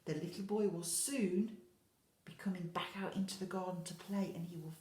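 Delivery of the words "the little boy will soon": ordinary delivery, nothing marked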